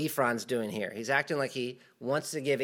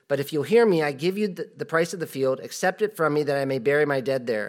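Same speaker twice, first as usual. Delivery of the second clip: raised, louder Bible reading voice; scripture reading; 2 semitones higher, 5 dB louder than usual. Acted. Preacher reads scripture aloud to church, louder. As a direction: read the verse out to the congregation.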